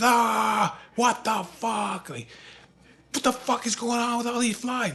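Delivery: drawn-out, high exasperated wail; losing his mind. Retold or imitated. Father standing up amid flies, losing his mind. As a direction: imitate a man driven crazy by flies. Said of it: "he's losing his fucking mind".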